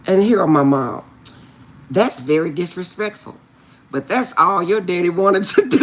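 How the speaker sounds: ordinary talk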